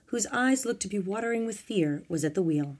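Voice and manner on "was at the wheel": plain reading voice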